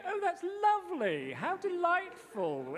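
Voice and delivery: high, slow British rise-fall delight; lingering rise-fall on lovely and delightful; posh delight. Shown as a demonstration. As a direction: gush over it like a polite Oxford local. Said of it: "they'd probably go".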